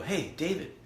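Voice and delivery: high-pitched hello, lifting at the end; retold friendly greeting. Greeting David outside the pool, retold friendly hail.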